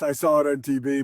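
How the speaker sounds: ordinary delivery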